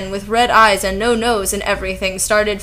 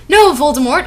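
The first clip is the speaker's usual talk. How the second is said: higher, moderately raised refusal; mild shout; 6 semitones higher, 5 dB louder than usual. A narrator's reading. Narrator refusing Voldemort, in a mild shout. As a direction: call back with a light acted shout. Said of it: "I shouted back".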